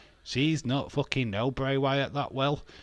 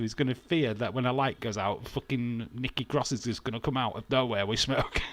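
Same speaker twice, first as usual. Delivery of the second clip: usual speaking voice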